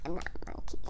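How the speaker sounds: hushed, unvoiced secret; a conspiratorial whisper; whispered aside. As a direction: whisper it behind the book's back. Whispered aside against the book.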